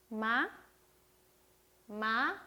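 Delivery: 마 gliding upward at the end; light rising tone. Shown as a demonstration. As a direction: lift 마 lightly, like a question. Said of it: "가볍게 올려주시면 됩니다".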